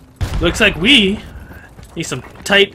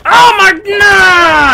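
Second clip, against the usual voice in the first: high, drawn-out cry of dismay; frustrated shout; 10 semitones higher, 5 dB louder, much slower than usual. Real, not acted. Gamer missing a kill, high drawn-out shout. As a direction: yell out the near miss, slowly and high.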